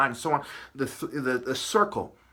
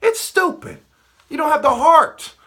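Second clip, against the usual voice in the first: shrieked high, then loud shouting; high-pitched, loud, indignant outburst; exasperated rebuke; 10 semitones higher, 7 dB louder than usual. Real, not acted. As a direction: shout it at them in outrage.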